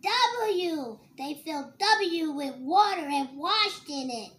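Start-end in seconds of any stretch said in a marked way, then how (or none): none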